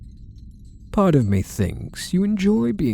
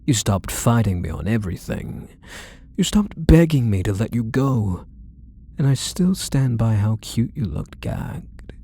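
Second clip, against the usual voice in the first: ordinary talk, nothing marked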